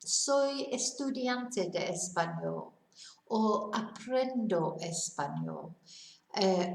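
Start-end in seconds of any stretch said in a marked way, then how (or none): 0.0-2.7 Soy estudiante de español said slowly and carefully; steady, deliberate Spanish phrase; slow Spanish demo. Shown as a demonstration. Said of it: "another thing you could say is". 3.7-5.8 aprendo español said slowly, falling at the end; careful teacher-paced aprendo español; slow Spanish demo. Shown as a demonstration. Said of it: "another thing you could say is".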